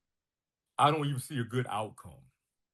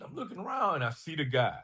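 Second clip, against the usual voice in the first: ordinary talk, nothing marked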